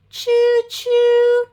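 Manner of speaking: high, bright choo-choo call; train whistle. Acted. Reading to a toddler, a high train choo-choo. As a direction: sing out the train whistle for a child.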